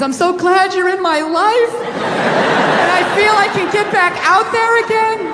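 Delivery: high, slow gushing tone; gushing woman. Retold or imitated. Women on the phone to friends after a bath, high and gushing. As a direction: coo like a woman unwinding over wine. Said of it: "they're calling friends".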